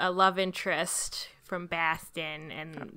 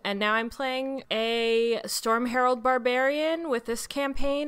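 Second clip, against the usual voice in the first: usual speaking voice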